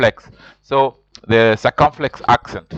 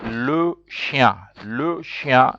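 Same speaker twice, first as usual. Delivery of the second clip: le chien said slowly, lo shyan; slow teacher model; much slower than usual. Shown as a demonstration. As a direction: spell out le chien slowly for learners. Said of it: "teacher modeling French".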